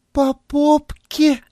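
high squeaky nymph voice, slow. A narrator's reading. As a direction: squeak it out coyly. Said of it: "пропищала она".

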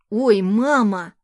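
loud, drawn-out playful squeal of protest; merry child's protest. A narrator's reading. Son fending off mother's kisses, merry protest. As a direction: protest loudly and slowly, in fun. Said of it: "весело смеясь".

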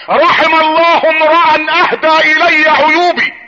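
high, very slow ringing recitation; solemn proclamation. Real, not acted. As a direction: proclaim each word, stretched out. Proclaims the inscription to the crowd. (إلقاء خطابي عالي وممدود)

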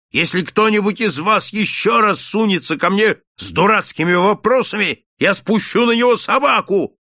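a shopkeeper shouting, high and loud; furious yelling. Acted. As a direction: bellow threats from the doorstep. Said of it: "орал он". (яростный крик торговца, громко и высоко)

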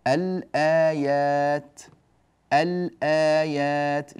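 الآيات with the ل clearly sounded and held; slow teacher's model of الآيات, every sound stretched; clear lam demo. Shown as a demonstration. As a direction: linger on the ل in الآيات, drawn out slowly. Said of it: "لاحظوا أننا أظهرنا اللام".

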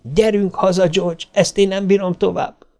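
high, overwrought wife; close to screaming. A narrator's reading. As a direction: hold back a scream as you plead. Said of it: "alig tudta megállítani, hogy föl ne sikítson".

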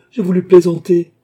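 protestation criée, aiguë et très forte; cri du malheureux. A narrator's reading. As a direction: se défendre en criant. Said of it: "cria le malheureux". (cri suraigu de malheureux aux abois)

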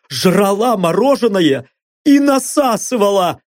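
high, shaky pleading captive; frightened blond. Acted. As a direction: talk fast to save your skin. Blond's voiced confession.

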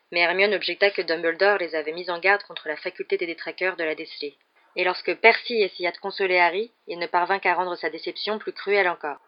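ordinary delivery

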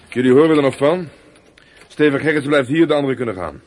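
loud police verdict; an officer laying down the law, loud; stern loud order. Acted. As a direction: lay down the law. Policeman dismisses boys, keeps Steven.

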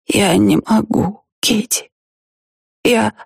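slow, plaintive near-sob; plaintive, barely audible. A narrator's reading. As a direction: fade to a pitiful whisper. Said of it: "Её голос стал жалобным, еле слышным".

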